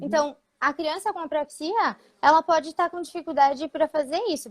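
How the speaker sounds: usual speaking voice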